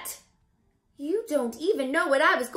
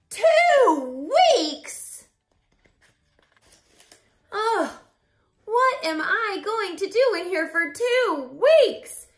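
dismayed; 5 semitones higher, 5 dB louder, slower than usual. Acted. Dismay at two weeks.

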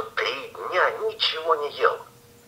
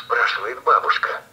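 usual speaking voice

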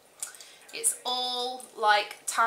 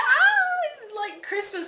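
laughing high squeal, much louder on the ah; much higher, giggly delight; excited squeal; 7 dB louder, slower than usual. Retold or imitated. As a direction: squeal like a kid at Christmas. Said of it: "I'm just like".

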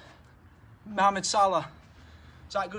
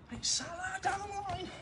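high, slow football commentator call; mock commentator; 10 semitones higher, 4 dB quieter, slower than usual. Pretend TV commentary during drill.

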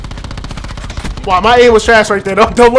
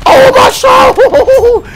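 very high, slow, loud wail of relief; overjoyed cry; 12 semitones higher, 4 dB louder, much slower than usual. Real, not acted. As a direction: cry out like you were just saved. Rescued by teammate in game.

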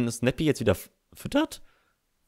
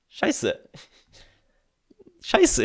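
shocked curse; 3 dB louder than usual. Real, not acted. Losing in the game.

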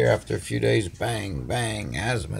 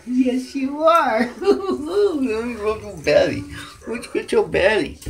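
high giggly coo at the cat; tender laughing pet talk; 10 semitones higher than usual. Real, not acted. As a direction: giggle while cooing over her.

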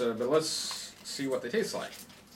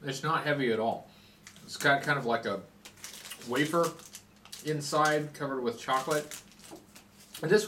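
speaking between bites; chewing while talking. Real, not acted. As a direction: mouth partly full. Seen 0: face clear; jaw and cheeks working, wrapped bar in hand throughout.

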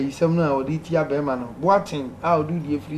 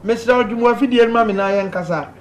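louder and higher, calling down a bad phone line; 6 semitones higher, 3 dB louder than usual. Real, not acted. Host calling out to a caller on a bad line, loud. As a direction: raise the voice so the caller can hear.

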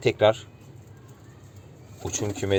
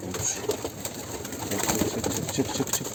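hushed whispered shooing; whispering while hiding; 7 dB quieter than usual. Real, not acted. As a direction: whisper it to not spook birds. Quietly shooing birds out.

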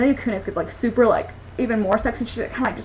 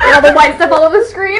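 laughing; 5 semitones higher, 8 dB louder than usual. Real, not acted. Laughing while recalling scene.

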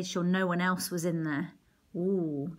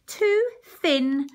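higher, louder grumble of disappointment; a witch cheated of her meal; complaining; 7 semitones higher, 7 dB louder than usual. Acted. Witch complaining Hansel is too thin, loud higher grumble. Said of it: "the old woman complained".

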